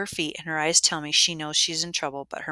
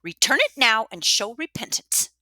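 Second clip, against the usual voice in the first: loud, higher, stern order; stiff command; 7 semitones higher, 7 dB louder than usual. A narrator's reading. Matron ordering Maddie to confess, stiff and stern. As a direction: demand repentance stiffly. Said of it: "she says stiffly".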